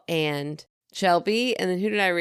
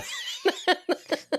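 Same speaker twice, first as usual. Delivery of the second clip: high laugh; 13 semitones higher than usual. Real, not acted. Laughing burst.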